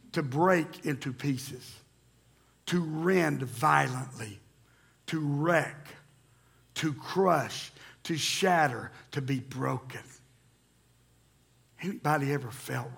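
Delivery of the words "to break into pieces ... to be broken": soft, slow, each meaning weighed; slow solemn list. Real, not acted. Pastor defining shaver to church, slow and soft. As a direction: let each word land heavily. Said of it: "slow list of word meanings".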